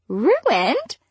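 sharp incredulous snap; snapped. A narrator's reading. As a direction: bite the word off in disbelief. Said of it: "Star snapped".

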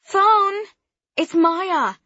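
high, loud call down the hall; yelling. Acted. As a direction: call out to someone far away. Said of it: "I can still hear her yelling".

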